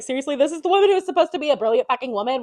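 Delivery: high, loud exasperated outburst; exasperated exclaiming. Retold or imitated. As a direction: sell every word like it ends in exclamation points. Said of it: "with, like, a bajillion exclamation points".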